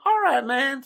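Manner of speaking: high put-on Kentucky caller; mock easygoing Kentucky customer voice; David. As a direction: agree in a high country caricature. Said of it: "he's from Kentucky".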